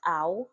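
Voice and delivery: "ow" glided from open ah into a rounded oo; ow sound demo. Shown as a demonstration. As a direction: sound out "ow" as one smooth glide. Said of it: "sounded as".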